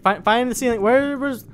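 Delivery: high, loud, frantic searching; mock panic. Player hunting for the surface before drowning, loud high panic. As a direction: call out in rising desperation.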